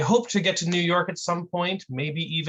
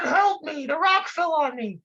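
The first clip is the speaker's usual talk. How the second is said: panicked trapped man; 13 semitones higher than usual. Acted. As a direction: yell up from under the rock, desperate. Trapped man repeats his plea.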